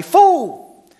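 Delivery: loud, high catchphrase shout; booming impression of a TV character; Mister T. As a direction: belt it out as a TV tough-guy catchphrase. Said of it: "used to always say".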